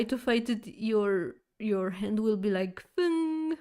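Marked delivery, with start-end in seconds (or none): 2.9-3.6 a high, sharp spoken clang; metal clang noise. Retold or imitated. Showing a fist hitting a metal robot, a mock clang. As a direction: mimic a hand hitting metal. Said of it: "making, like, this sound on the metal".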